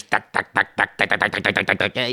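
staccato arcade ticks speeding up; imitated Space Invaders noises. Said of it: "Space Invaders".

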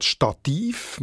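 Stativ stressed on a long -TIV; stress on second syllable. Shown as a demonstration. Editor naming the exception, Stativ stressed on -tiv. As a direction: lean on the end of Stativ. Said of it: "wo betont wird wie das latinische Original".

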